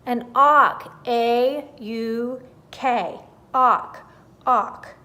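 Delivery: loud, slow, spelled out A U K; put out, spelling it. A narrator's reading. The irritated auk spells its name for the dodo, loud and slow. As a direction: spell it for a slow listener, exasperated. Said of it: "The auk was a little put out".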